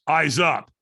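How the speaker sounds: loud, sudden order; acted trainer's call; trainer's sharp command. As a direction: call out to snap someone to attention. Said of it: "all of a sudden the trainer goes".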